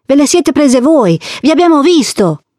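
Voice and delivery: accusa ferma, con voce acuta. A narrator's reading. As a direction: tieni testa all'adulto, tono alto e deciso. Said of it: "Lila disse ferma". (bambina ferma e coraggiosa)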